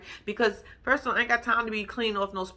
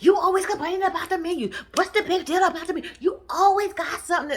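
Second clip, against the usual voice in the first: high, rapid-fire mock whining; exaggerated high-pitched protest; mock complaining; 8 semitones higher, 3 dB louder than usual. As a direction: imitate a defensive coworker griping, pitched way up. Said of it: "She was like".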